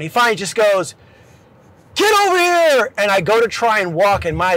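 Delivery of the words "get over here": mock instructor yell. Instructor summoning wobbly trainee, high mock yell. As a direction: imitate a drill instructor calling someone over.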